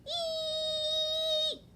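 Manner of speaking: very high shrill eek; owlet screech. Acted. Little owl trying his grown-up call, screeching high. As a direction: screech like a stepped-on cat. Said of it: "he screamed".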